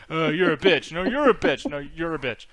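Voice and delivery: mock movie trash talk; Rock trash talk. Imitating the Rock insulting Jason Statham, mock tough. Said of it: "The Rock and talk shit".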